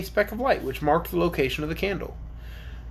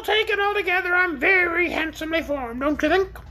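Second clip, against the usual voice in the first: high-pitched creature voice, pleased with itself; vain orc; 13 semitones higher than usual. Acted. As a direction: speak up high as a self-admiring orc. Orc speaks in acted figure voice.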